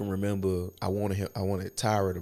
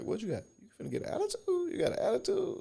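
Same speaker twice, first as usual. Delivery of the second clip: much higher, taunting street challenge; mock picking a fight, pitched way up; mock confrontational challenge; 3 dB quieter, faster than usual. Retelling his old aggressive self.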